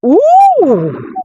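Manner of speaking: high, loud scandalised ooh; shocked ooh. Real, not acted. Vlogger reacting to the heated courtroom, shocked ooh. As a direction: gasp at how heated it got.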